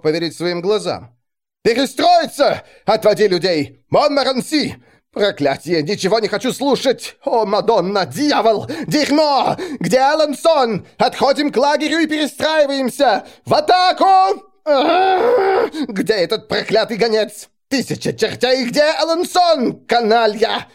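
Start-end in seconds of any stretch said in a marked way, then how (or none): 1.6-9.6 high, loud furious raging; furious roaring king. Acted. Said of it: "Валуа рычал и ревел". 9.8-10.9 high, loud, slow furious demand; furious roar. Acted. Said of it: "Валуа рычал и ревел". 11.0-20.7 high, loud furious raging; furious roaring king. Acted. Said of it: "Валуа рычал и ревел".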